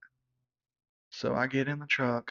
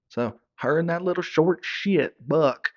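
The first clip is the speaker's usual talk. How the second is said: ordinary talk, nothing marked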